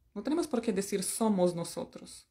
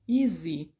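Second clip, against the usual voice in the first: Grey's Anatomy character; 4 semitones lower than usual. Retold or imitated. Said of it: "la personaje de Grey's Anatomy".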